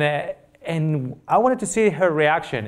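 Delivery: ordinary talk, nothing marked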